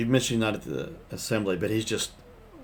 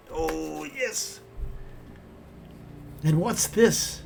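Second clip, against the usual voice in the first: put-on old prospector; 7 semitones higher, much slower than usual. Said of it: "like an old prospector".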